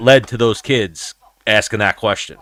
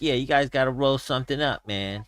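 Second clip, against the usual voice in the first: softer, lightly imitated fighter talking to his team; Nate Diaz; 3 semitones higher, 7 dB quieter than usual. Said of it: "he started talking to his team".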